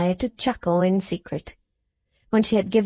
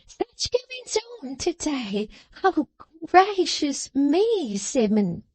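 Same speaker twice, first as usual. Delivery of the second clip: high, slow simpering maid; high drawn-out simpering servant girl; Miggs simpering; 7 semitones higher, much slower than usual. A narrator's reading. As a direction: coo his name like a fawning maid. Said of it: "said the young lady".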